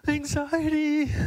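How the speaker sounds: high, slow drawn-out Anxiety; mock announcer. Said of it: "They're like".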